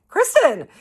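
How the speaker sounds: high, loud shocked name; acted scolding squeal; scandalized exclamation. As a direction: cry out the name in mock horror. Scandalised by friend's hidden flask.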